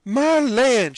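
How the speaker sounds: high, loud burst of delight; aunt suddenly recognising her nephew; joyful exclamation. Acted. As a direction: cry out in happy surprise. Aunt Sally recognising Sid.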